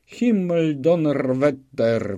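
officer's exasperated yell; yelled curse. A narrator's reading. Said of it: "wrzeszczał jeden z członków komisji".

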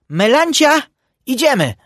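loud, high call to his wife; high-pitched duck hollering. A narrator's reading. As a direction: summon her urgently. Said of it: "wołał na żonę".

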